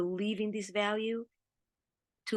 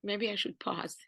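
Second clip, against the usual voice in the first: softer, tearful, steadying herself; composing herself; 7 dB quieter than usual. Real, not acted. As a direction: gather yourself quietly after tears. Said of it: "I'm trying not to cry".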